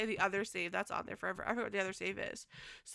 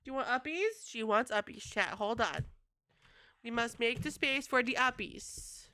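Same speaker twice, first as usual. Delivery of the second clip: normal talking voice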